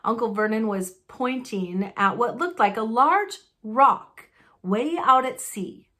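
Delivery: ordinary delivery, nothing marked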